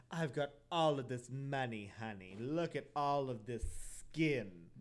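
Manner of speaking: a hint of a posh diva, slowed; lightly voiced rich lady. Imagined rich widow bragging, lightly voiced. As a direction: show off like a lightly mocked rich socialite. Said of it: "she was probably like".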